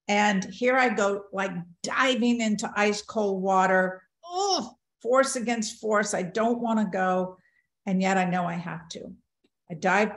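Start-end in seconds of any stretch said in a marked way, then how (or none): 4.2-4.7 high "ooh" of shock; sharp, high intake of dread; icy-plunge gasp. Retold or imitated. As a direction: gasp as if hitting ice water. Diving into ice cold water.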